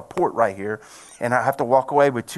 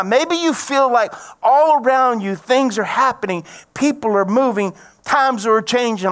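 raised-pitch sermon delivery, building in urgency; impassioned preaching; 6 semitones higher, 3 dB louder than usual. Real, not acted. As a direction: preach it with rising fervor. Preaching the story of Bartimaeus.

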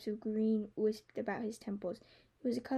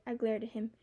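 plain reading voice

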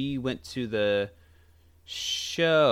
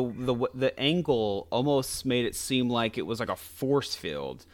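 ordinary talk, nothing marked

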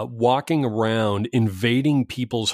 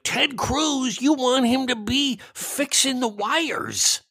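high mocking whine of a clueless critic; mocking a jerk; 13 semitones higher, slower than usual. Mocking online critics of Ted Cruz, high whiny voice. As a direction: squeak it out like a dopey heckler. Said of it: "jerk offs who are like".

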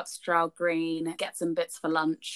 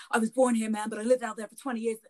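raised, hurried mock of a neighbour; lightly put-on voice, rattling out his excuse; imitated American neighbour; 3 semitones higher, faster than usual. Woman retelling neighbour's retort in the lift, hurried imitation. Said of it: "oh, he's like".

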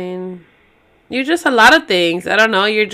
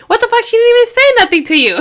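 high shrill outraged exclaim; shrill screeching bird woman; 12 semitones higher, 4 dB louder, faster than usual. Retold or imitated. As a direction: shriek it high and fast in disbelief. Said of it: "And you'd be like".

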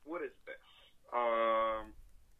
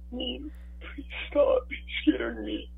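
mock scared; 13 semitones higher, 5 dB louder, slower than usual. Scared by creepy whisper.

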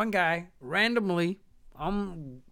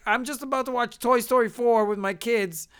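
ordinary talk, nothing marked

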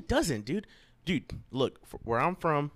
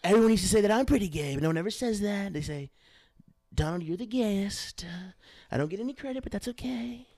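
Donald Trump; 7 semitones higher than usual. Acted. Host as Walker's hero bragging, presidential impression. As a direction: play the boastful politician, pitched up. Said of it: "Sounds like Walker's hero talking".

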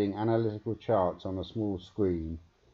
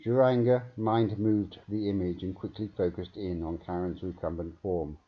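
usual speaking voice

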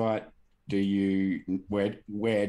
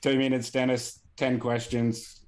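higher, louder game show announcing; quiz host voice; 6 semitones higher, 4 dB louder than usual. Acted. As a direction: set the challenge like a host. Quiz host starting the round.

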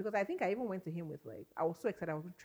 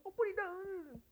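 calling out; 12 semitones higher, 6 dB quieter, slower than usual. Retold or imitated. Calling through a locked door.

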